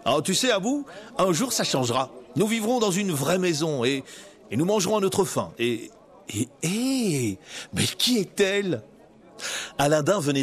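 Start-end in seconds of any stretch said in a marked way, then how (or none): 0.1-8.8 put-on lightly higher hopeful young man; dreamy boy lost for words at the end; young Aladdin. Aladdin voiced in the story. (Aladin jeune, un peu plus aigu)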